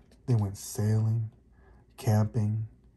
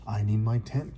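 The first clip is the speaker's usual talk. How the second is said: ordinary delivery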